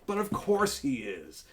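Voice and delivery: normal talking voice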